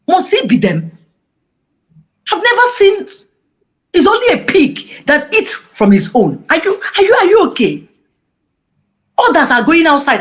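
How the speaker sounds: wife scolding the pastor, high and indignant; indignant confrontation. Retold or imitated. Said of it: "I say".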